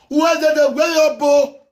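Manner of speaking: high, loud and slowed taunting yell; mocking shout. Real, not acted. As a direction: shout him down, dragging the words. Taunting outburst at rival.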